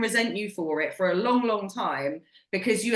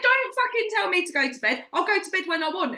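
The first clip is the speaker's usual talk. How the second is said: mock annoyed rant; 10 semitones higher than usual. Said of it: "I was like".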